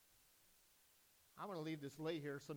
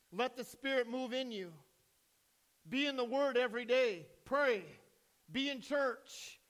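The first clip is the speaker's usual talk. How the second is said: louder, ringing exhortation to the flock; full-force preaching; 6 semitones higher, 7 dB louder than usual. Acted. Pastor urging the congregation, preaching louder. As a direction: drive each command home to the congregation.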